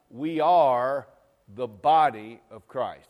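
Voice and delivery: loud and slow on "body"; emphatic declaration. Acted. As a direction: proclaim it to the church.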